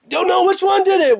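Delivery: squeaky high-pitched complaint. Pretend. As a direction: raise the voice way up in mock pain. High-pitched mock pain.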